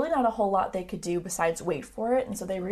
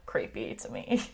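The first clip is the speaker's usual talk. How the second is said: whispered; 9 semitones lower, 5 dB quieter than usual. Real, not acted.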